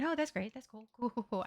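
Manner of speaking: soft, tossed-off mock approval; spiteful fake approval. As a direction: pretend it's fine, out of spite. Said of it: "Out of spite".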